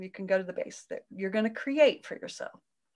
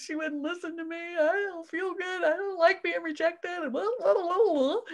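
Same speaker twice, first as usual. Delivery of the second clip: much higher, whiny rejected inner voice; hurt inner voice; 9 semitones higher than usual. Retold or imitated. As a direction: whine like a rejected child. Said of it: "making up all kinds of stories".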